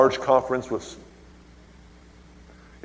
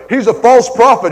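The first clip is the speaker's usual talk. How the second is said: "false" hit hard, much higher and louder; shouted accusation; 10 semitones higher, 12 dB louder than usual. Retold or imitated. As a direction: yell it, slamming "false". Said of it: "my wife's saying".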